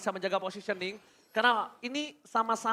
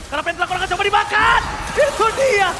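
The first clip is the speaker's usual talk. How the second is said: loud, high hype caster shouting; fighting game announcer; 8 semitones higher, 7 dB louder than usual. Acted. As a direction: yell the kills like an arena announcer. Hype teamfight call.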